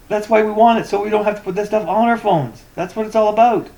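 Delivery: loud, high-pitched protest; heated insistence. Real, not acted. Customer arguing back at the moderator, heated. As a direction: drive the point home, loud and high.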